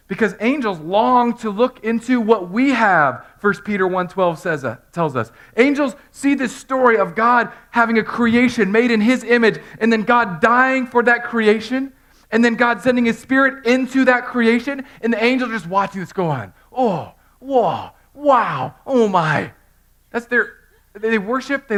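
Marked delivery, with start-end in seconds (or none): 0.1-16.5 loud, high, impassioned preaching; preaching at full force. Acted. Preacher builds again. 16.7-19.5 breathless, amazed little gasps of wonder; awed angels. Retold or imitated. Angels watching in awe.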